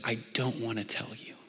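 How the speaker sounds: whispered, hiding in tears; tearful whisper. Retold or imitated. Daughter hiding under covers, tearful whisper. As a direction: breathe it out like a guilty child hiding under covers. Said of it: "And she's crying, big tears".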